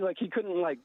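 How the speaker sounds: usual speaking voice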